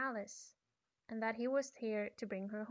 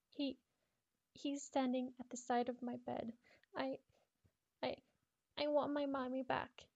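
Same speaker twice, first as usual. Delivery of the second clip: higher stammering, close to tears; scared crying girl; 4 semitones higher than usual. Acted. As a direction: stammer like a child about to cry. Stammering girl, teardrops on page.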